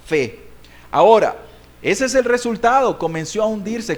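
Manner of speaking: normal talking voice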